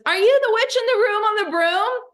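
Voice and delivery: put-on high, loud child's question; little girl's voice. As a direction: pipe it up like an eager little girl. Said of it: "She goes".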